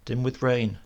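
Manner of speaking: ordinary delivery, nothing marked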